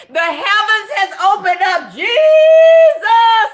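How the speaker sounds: loud, high joyful cry of praise; overjoyed exclaim. Real, not acted. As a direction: shout it like a miracle happened. Friend joins stream, overjoyed.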